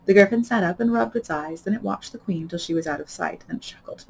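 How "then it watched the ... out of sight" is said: ordinary delivery, nothing marked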